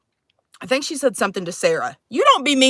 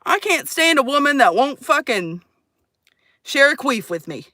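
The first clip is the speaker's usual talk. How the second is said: usual speaking voice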